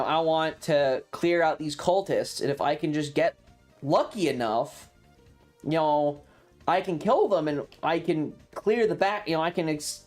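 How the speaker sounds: usual speaking voice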